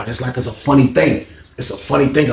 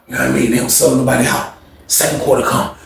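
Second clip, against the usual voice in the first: usual speaking voice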